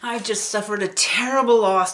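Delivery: barely holding back sobs; sobbing father. Retold or imitated. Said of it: "tears were streaming down his face".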